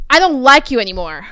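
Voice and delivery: loud imitation of mom snapping; exasperated outburst. Showing how mom snapped at the child, loud outburst. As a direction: say it loudly, like a fed-up mom. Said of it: "My mom, she's like this".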